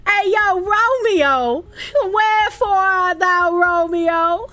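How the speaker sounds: put-on high, loud Boston-accented Romeo call; Bostonian Shakespeare. Streamer joking that Shakespeare sounded Bostonian, hammed-up Boston accent. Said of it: "it's Bostonian that's closest".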